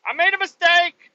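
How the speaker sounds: very high, loud frantic squeal; panicked whimper. Retold or imitated. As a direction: freak out in a corner over the blunder. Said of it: "Poland just goes and cries in the corner".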